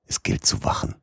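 whispered, breathless warning. A narrator's reading. Danger near, keep watch. (geflüstert)

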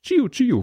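hohes Laser-Tschiu; Kit-Geräusch. A narrator's reading. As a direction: das Knight-Rider-Auto hoch nachmachen. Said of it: "imitiere ich das klassische Kit-Geräusch". (hohes imitiertes Kit-Geräusch)

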